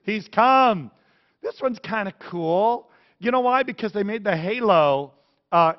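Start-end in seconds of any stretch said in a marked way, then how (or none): 0.0-0.9 high, loud excited shout from the boat; excited onlookers. Pretend. Sailors calling out to the glowing Jesus, high loud excitement. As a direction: yell it out like sailors spotting Jesus. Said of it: "They're gonna see, like".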